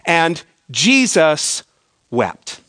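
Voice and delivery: very slow, pause before the last word; mock-solemn recitation. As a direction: recite it gravely, dropping low at the end. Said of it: "It goes something like this".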